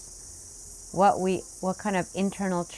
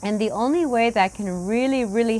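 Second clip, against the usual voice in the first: guided meditation; 4 semitones higher, 4 dB louder than usual. Acted. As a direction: settle the room into stillness. Opens guided sitting instruction.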